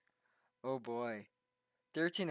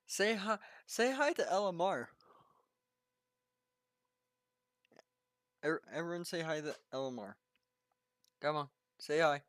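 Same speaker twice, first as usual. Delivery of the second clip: excited calling out; 6 semitones higher, 5 dB louder than usual. Real, not acted. Calling chat to greet someone.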